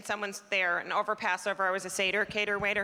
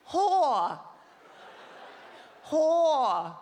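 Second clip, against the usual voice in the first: put-on Japanese princess accent; 5 semitones higher than usual. Comic calling a waitress over, mock Japanese accent. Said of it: "she goes like this".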